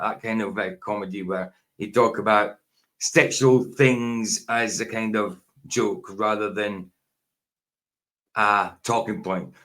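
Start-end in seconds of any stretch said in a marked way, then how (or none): none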